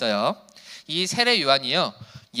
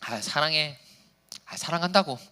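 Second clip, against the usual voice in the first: flat, offhand, a bit softer, as if it's a chore; dry, bored I love you; 3 semitones lower, 6 dB quieter than usual. Shown as a demonstration. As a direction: toss off the love words with no feeling. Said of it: "그냥 몸이 건조하게". (건조하고 성의 없게 사랑한다는 말)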